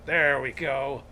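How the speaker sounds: accidental pirate voice. Acted. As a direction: slip into a pirate for one line. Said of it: "I sounded like a pirate there".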